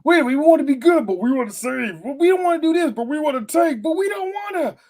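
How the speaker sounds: high, whiny voice of a team that can't decide; mocking indecisive team. Mocking the Eagles as a dithering team, high whiny voice. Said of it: "fighting themselves of like".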